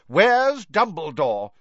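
loud, high, slow demand; Fudge's demand. A narrator's reading. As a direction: demand an answer, leaning hard on each word. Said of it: "Fudge demanded of Missus Weasley".

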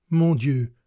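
sudden loud cry; a breathless outcry. A narrator's reading. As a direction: cry out in overwhelmed shock. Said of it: "m'écriai-je".

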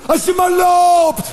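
high, slow drawn-out yell; shouting at the TV. Retold or imitated. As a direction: bellow it at the screen. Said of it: "schreeuwen naar een televisie". (langgerekte schreeuw naar de tv)

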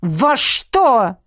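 loud, irritated bark. A narrator's reading. Dragon told to get dressed, barking back. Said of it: "рявкнул дракон".

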